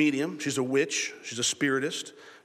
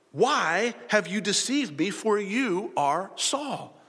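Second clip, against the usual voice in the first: high, slow dramatic accusation; loud cry; 5 semitones higher, much slower than usual. A narrator's reading. Woman crying out to Saul, slow high accusation. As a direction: read it as an understated outcry. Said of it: "she cried out with a loud voice".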